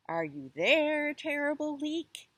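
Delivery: monkey calling, slow then high on terrible leak; crying out. A narrator's reading. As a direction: call down the hole nervously. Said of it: "cried the monkey".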